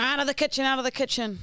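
put-on nonno shooing kids in a light Italian accent. Grandfather shooing kids from kitchen, Italian accent. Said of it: "Well, in his Italian accent".

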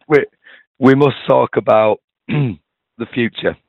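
hoarse, failing voice; slow, with the voice cracking away; voice giving out. Real, not acted.

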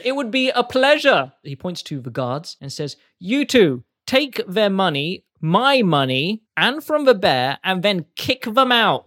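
loud, high gloating orders; gloating boss. Acted. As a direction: relish bossing the guards about. Penny mocks and orders guards.

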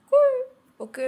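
fake cheerful cool. Telling herself the new fringe is fine, forced cheer. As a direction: force the word out in a shrill pretend calm.

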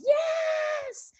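very high-pitched, drawn-out squeal; high imitated scream of glee; mock toddler scream. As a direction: imitate a toddler shrieking with joy, without full volume. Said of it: "screaming at the top of her lungs".

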